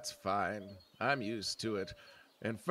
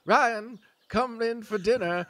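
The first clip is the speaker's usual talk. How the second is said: calling out; 9 semitones higher, 6 dB louder than usual. Retold or imitated. Old man retells calling grandson.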